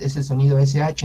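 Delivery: normal talking voice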